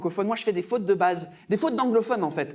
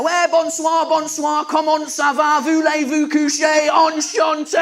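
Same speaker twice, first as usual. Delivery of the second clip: loud, higher, hammed-up English tourist speaking French; mock English accent in French; 4 semitones higher, 6 dB louder, slower than usual. Englishman greeting French people, mock English accent. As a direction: blare clumsy textbook French like a proud Englishman. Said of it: "normalement, on est là".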